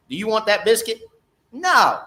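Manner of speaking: ordinary talk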